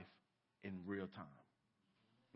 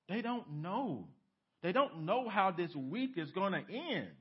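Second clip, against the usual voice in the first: ordinary delivery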